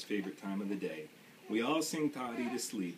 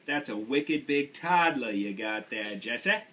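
louder, slow Maine uncle marvelling; Uncle Bert's Maine voice; 3 semitones higher, 6 dB louder, slower than usual. Acted. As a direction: boom it out like an impressed Maine uncle. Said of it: "Uncle Bert whistled".

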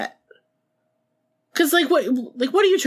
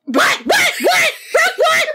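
very high, loud, laughing string of whats, building fast; frantic what; 4 dB louder, slower than usual. Retold or imitated. Imitating [name] hearing [name] is here, high laughing squeal. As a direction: squeal it like you just heard the crush is in the room. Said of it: "That's what you would sound like".

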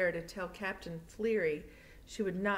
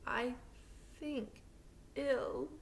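put-on weak old voice; 7 semitones higher, 4 dB quieter, much slower than usual. Sick Granny answers the doctor.

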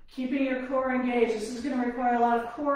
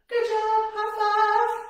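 excited cheer; 9 semitones higher, 3 dB louder, slower than usual. Real, not acted. Cheering after an exercise set.

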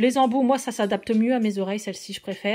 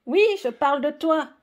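high, loud playful teasing aimed at the pet; teasing the cat; 5 semitones higher, 5 dB louder than usual. Real, not acted. Teasing her cat for always climbing into boxes, high and loud. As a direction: call the cat out, grinning.

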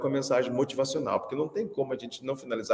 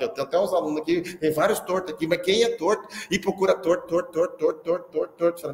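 ordinary talk

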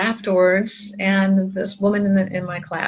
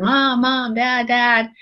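put-on higher-pitched kid calling for a parent; lightly acted insistent kid; pestering child; 5 semitones higher, 4 dB louder, slower than usual. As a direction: nag like a child wanting attention.

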